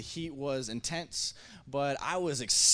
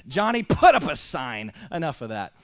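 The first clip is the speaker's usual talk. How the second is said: mock fed-up order; 3 semitones higher, 10 dB louder than usual. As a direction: act out a boss who has had enough. Imagined fed-up pool boss order.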